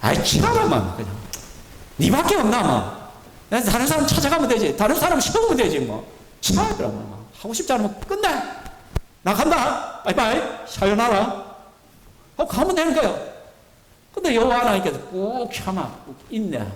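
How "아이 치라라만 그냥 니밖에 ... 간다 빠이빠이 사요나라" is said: fed-up God. Retold or imitated. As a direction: wave them off in exasperation, then toss a jaunty goodbye. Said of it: "저 같으면 그냥 막 뒤집어 엎어버린다".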